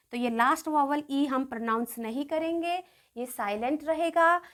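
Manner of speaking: usual speaking voice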